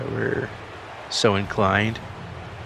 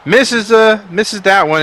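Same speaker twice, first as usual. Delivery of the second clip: excited call; 10 semitones higher, 6 dB louder, slower than usual. Real, not acted. Missed free throw, excited call.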